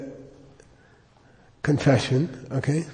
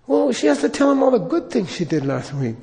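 much higher, explaining innocently; earnest Lama; 8 semitones higher than usual. Retold or imitated. Lama proposing a rejoicinal to the priest, earnest. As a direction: lay out the idea simply. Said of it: "And he says".

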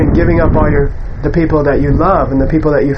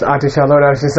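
ordinary talk

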